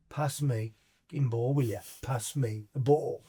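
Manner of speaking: slow, deliberate words; acted firm demand. Player cornering Gaza at half time, slow and deliberate. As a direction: spell it out slowly for him.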